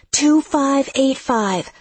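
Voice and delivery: high, slow, spaced-out shout; yelling. A narrator's reading. Lex yells the house number to Seth, shouting. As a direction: yell each number slowly over noise. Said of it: "and I yell".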